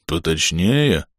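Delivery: low, slow dim-witted echo; playing dumb. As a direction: act the fool. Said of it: "прикинувшись глупым валенком".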